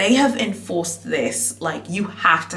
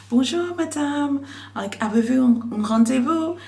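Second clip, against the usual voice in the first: imitated French greeter; 6 semitones higher than usual. Greeter asking a woman about her appointment, in higher-pitched imitated French. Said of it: "this is what she said in French".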